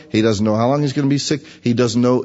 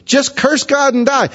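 wife's plea pitched much higher and louder; urging wife; 12 semitones higher, 3 dB louder than usual. Retold or imitated. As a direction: cry it out as the desperate wife, way up high. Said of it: "She says".

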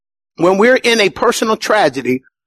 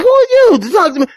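mock indignant shout; 10 semitones higher than usual. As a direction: snap back at a new convert. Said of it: "we're like".

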